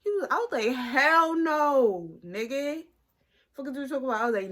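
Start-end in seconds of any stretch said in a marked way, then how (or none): 0.8-4.2 mock outraged refusal. Recalling her answer to his flirting, mock outraged. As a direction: shut him down like he's crazy.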